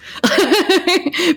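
high giggle; short high burst of laughter. Real, not acted. Refusing to do the teachers' accent, high laugh.

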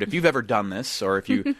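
ordinary talk, nothing marked

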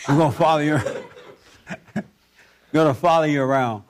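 talking through laughter; laughing. Real, not acted. Host teasing guest about a reality camera, laughing. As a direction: joke breaking up into laughs.

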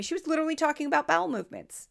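normal talking voice

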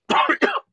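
sudden harsh cough mid-sentence; coughing fit. Real, not acted. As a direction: cough to clear the throat. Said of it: "Sorry, I'm just choking".

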